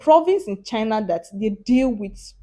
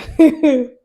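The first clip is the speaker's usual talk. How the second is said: pained laugh; 6 semitones higher, 11 dB louder than usual. Real, not acted. Reacting to Chinese food.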